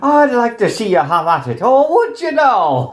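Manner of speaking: high, swooping mock-Irish lilt; mock Irish accent. Daring him in mock Irish accent.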